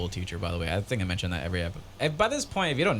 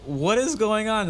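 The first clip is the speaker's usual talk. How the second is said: high-pitched distressed whine; near tears; 11 semitones higher, 3 dB louder than usual. Acted. Dreamer reacting to teeth falling out, near tears. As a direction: wail it in mock bewilderment. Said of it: "I'm practically crying".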